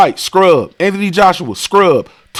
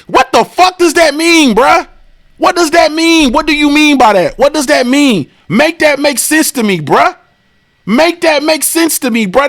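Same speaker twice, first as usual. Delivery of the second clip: exasperated rant; 8 semitones higher than usual. Real, not acted. Ranting at a commenter about a dumb take. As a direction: demand an answer in disbelief.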